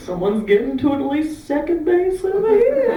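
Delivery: high, amused mock commuter voice; gossiping passengers. Passengers gossiping about the call, a high amused mock voice. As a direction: chuckle it like a nosy passenger. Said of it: "everyone around you on the bus is going".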